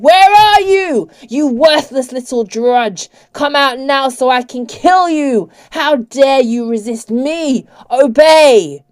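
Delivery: loud, slow, high raging shout; furious, drawn-out commands; enraged shout. A narrator's reading. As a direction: bellow with rage breaking out. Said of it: "broke with rage and he shouted".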